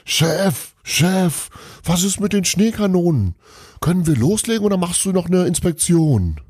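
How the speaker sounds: high calling across a hall; shouting. A narrator's reading. Elf calling Santa across the hall, urgent shout. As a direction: call out urgently to the boss. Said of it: "rief jemand".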